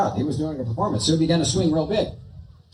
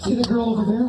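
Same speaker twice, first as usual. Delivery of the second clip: dummy voice; 5 semitones higher than usual. Acted.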